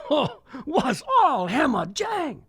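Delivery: ordinary delivery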